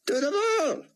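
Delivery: put-on a frail old rabbi straining through a thick Hebrew accent. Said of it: "came the thick Hebrew accent".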